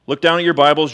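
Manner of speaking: ordinary delivery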